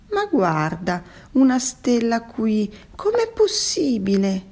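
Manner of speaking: mock amazement, pitched high; exclaiming. A narrator's reading. Squid finding a star beneath him, exclaiming. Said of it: "esclamò".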